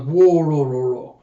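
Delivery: loud rolling laugh that drops off; imitated villain laugh. Villain laughs at the pleading vassals, imitated rolling laugh. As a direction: roll out a big laugh, tumbling downward.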